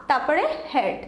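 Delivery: ordinary delivery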